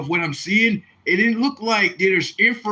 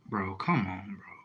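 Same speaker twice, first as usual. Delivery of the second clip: whispered frustration; 9 semitones lower, 11 dB quieter than usual. Real, not acted. Frustrated at device.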